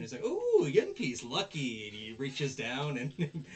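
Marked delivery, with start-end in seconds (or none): 0.2-1.9 high, drawn-out ooh, louder; gleeful at finding money; delighted coo. Retold or imitated. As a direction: Onizuka thrilled by a coin, pitched way up. Onizuka spots a coin.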